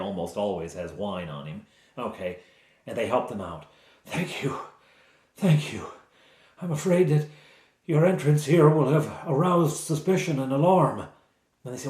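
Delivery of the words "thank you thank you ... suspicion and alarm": higher, halting put-on voice; grateful, then worried, with pauses; freed captive knight. Rescued knight warning his rescuers about the alarm, worried. Plays freed Sir Trevor.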